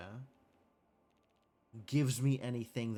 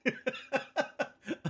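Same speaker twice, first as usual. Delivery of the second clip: a sudden high giggling laugh; bright laughing outburst; high burst of laughter; 13 semitones higher, 4 dB louder than usual. Real, not acted. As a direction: crack up at your own joke. Laughing at own joke.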